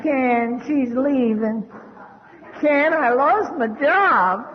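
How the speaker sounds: slow, drawn-out mock whine; whining wimp. Wimps phoning their sponsor with troubles, whiny. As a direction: mimic a wimp moaning, words stretched out.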